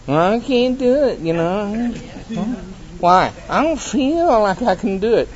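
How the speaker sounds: a hint of a self-pitying complainer; whiny excuse. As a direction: play the reluctant believer, lightly. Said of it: "when it's your turn".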